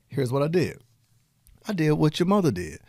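ordinary delivery

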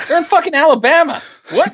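high-pitched outburst of disbelief; incredulous. Real, not acted. Co-host baffled by the film's Alabama setting, incredulous. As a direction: exclaim it, voice leaping far up.

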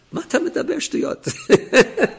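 high, slow, laughing disbelief; mock the nonsense you just heard; scoffing laugh. Officer scoffing.

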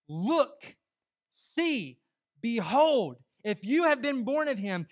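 loud, high pulpit proclamation; emphatic preaching. Real, not acted. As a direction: drive each phrase home with drawn-out key words. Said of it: "I'm being a little emphatic this morning".

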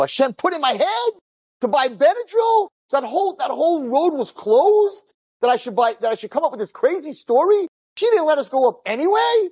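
very high, questioning exclamations; rhetorical incredulity. Real, not acted. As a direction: marvel in a high voice, as if asking how. Rhetorical disbelief at providence.

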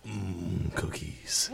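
low, soft, drawn-out 'mmm' of relish; deep, hushed novelty-toy voice; talking belly button. Pretend. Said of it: "It'll go".

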